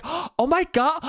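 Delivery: high, gasping mock shock; hammed-up horror with big gasps; scandalised Karen. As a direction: imitate an affronted Karen gasping. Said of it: "as a Karen does".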